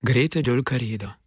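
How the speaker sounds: normal talking voice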